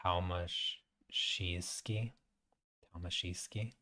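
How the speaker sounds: slow, soft "Tomaszewski?" with a rising question; Polish pronunciation, testing. Shown as a demonstration. Checking the name with Tina, soft careful Polish. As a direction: carefully try the Polish "Tomaszewski", quiet and unsure. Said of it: "that's how you guys say it".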